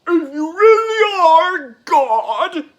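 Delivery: loud, high mocking shout; jeering quip. Pharisee daring Jesus to prove he is God, loud jeer. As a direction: throw the challenge out loud, drawing out the end.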